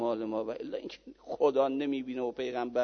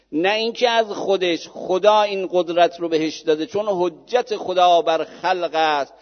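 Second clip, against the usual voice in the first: rising, louder insistence on مثل خدا; firm declaration; 6 semitones higher, 9 dB louder than usual. Retold or imitated. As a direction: declare it with growing force. Cleric's bold answer to Wahhabi. (اعلام قاطع و پرشور)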